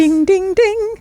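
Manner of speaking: high sung "ding, ding"; musical flourish. Pretend. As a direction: chime like a show-tune accompaniment. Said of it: "like, song of discovery".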